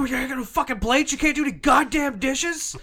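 very high, loud kitchen meltdown; hammed-up outraged tirade; screaming. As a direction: shriek it like an enraged chef mid-service. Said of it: "We scream at each other".

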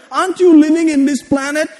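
high-pitched scolding relative; lecturing relative. Retold or imitated. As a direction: raise the pitch, nag like an elder. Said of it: "They gave me a lecture".

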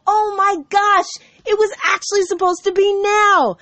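high, excited burst of realisation; amazed exclamation. Retold or imitated. Recalling her delight when delayed work drops, amazed. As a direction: exclaim it high, as a sudden revelation. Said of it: "I go".

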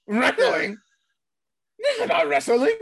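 high, laughing mock outrage at the word wrestling; a hammed-up Vince McMahon scoffing at the word. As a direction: squeal it in disbelief like an outraged old boss. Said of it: "if somebody says wrestling".

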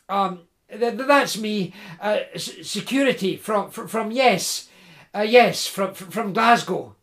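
nervous, halting, tripping on every no; flustered stammer. Acted. Jim nervously denying to the woodsman, stammering. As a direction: fumble for an excuse.

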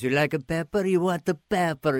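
lightly hammed-up Italian-American accent; Italian pepper guy. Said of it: "these fanatical Italian pepper guys".

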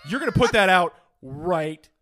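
loud, halting mock reprimand; officious student official. Student association member ordering boys to stop smoking, loudly officious. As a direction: pause hard between phrases like a self-important authority.

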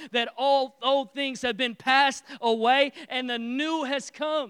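loud, rising sermon build; preaching at full force. Acted. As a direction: preach it with growing fire.

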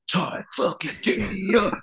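high, hammed-up metal yell without full volume; imitated metal tagline. Acts out the breakdown tagline yell.

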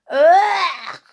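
long, loud, high "ugh"; squealed groan of revulsion; disgusted ugh. Acted. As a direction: recoil from the taste with a drawn-out yuck. Moo tastes honey, pulls face.

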